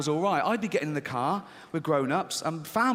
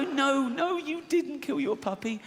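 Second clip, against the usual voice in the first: reassuring; 10 semitones higher, slower than usual. Acted. Soothing the child.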